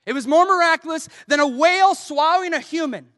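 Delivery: high, loud, urgent sermon delivery; impassioned preaching. Real, not acted. Preacher urges congregation to risk sharing faith, impassioned preaching. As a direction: drive the exhortation home at full force.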